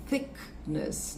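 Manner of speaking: "Thickness" breathed almost unvoiced; soft th. Shown as a demonstration. Teacher quietly modelling "Thickness". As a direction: hush the th in "Thickness". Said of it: "olha só".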